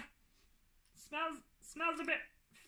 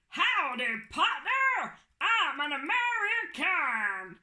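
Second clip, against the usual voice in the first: cowboy voice; 3 semitones higher, 5 dB louder than usual. Pretend. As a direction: play a mock American cowboy. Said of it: "you sound like a cowboy".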